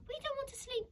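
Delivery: put-on high pleading children's voices; children crying out. Orphans begging Elsie for another story, high and pleading. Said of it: "the other orphans cried out".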